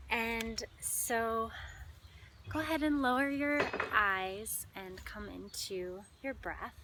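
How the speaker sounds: measured meditation voice with long pauses; guided meditation. Acted. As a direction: guide listeners unhurriedly into their breath.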